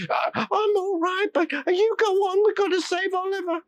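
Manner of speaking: put-on very high, quavery old lady; fluttering and anxious; a flustered old village lady. A narrator's reading. Injured old lady urges them on.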